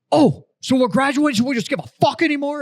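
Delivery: high, loud scornful sneer; mocking drill sergeant. Drill sergeant sneering at recruit, high and loud. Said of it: "Drill Sergeant Plummer's just like".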